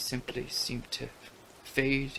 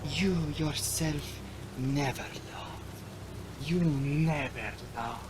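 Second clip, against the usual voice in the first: slow, higher mocking coquette; taunting vampire woman; 5 semitones higher than usual. A narrator's reading. The fair girl mocking the Count, slow and teasing. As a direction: tease him slowly with a sneer.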